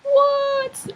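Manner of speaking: a squeaky, disbelieving what; mock astonished outburst; shocked what. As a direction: leap way up in pitch, stunned. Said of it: "he was like".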